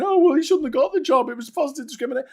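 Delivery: high-pitched, whingeing mimicry; hurried, high mock grumble; mock complaining. Mocking complainers about a hiring.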